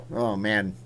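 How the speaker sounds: usual speaking voice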